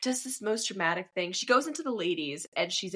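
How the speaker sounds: normal talking voice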